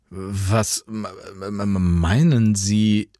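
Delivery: halting, stuck on the m; stammering. A narrator's reading. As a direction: stumble over the words, confused. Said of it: "stammelte Jan irritiert".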